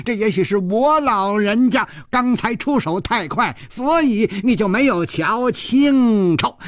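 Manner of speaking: ordinary delivery, nothing marked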